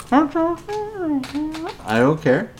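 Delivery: high, very slow sing-song I don't care; mocking don't care. Real, not acted. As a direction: stretch it out high to mock him. Mocking Steven's complaint.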